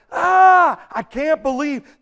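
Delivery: loud, high mock cry of terror; acted terror. Preacher acting out John's uncontrollable weeping, mock terrified wail. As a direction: wail it out in hammed-up anguish. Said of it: "the kind of terror".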